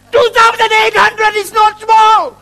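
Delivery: high, loud, drawn-out protest; indignant outburst. Acted. Objecting to calling the offering small, indignant shout. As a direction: wail it slowly in mock outrage.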